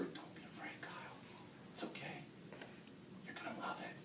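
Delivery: eerie, very soft whisper. Acted. Entranced kid coaxes frightened Kyle, whispering. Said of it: "I heard one of them whisper".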